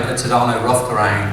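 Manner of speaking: normal talking voice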